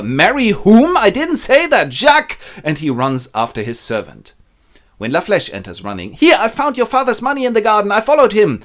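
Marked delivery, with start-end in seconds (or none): 0.0-2.4 high, loud shocked protest; indignant outburst. Acted. Father realizes he was tricked. 6.1-8.6 high, loud urgent announcement; excited servant. Acted. Servant runs in with news.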